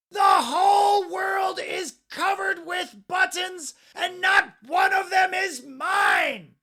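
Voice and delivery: high, furious scream; screaming tantrum. A narrator's reading. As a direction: scream it in a stamping rage.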